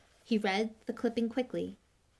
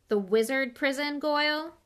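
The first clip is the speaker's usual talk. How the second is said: higher, louder rising question; disbelief; 6 semitones higher, 6 dB louder than usual. A narrator's reading. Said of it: "looking at him in disbelief".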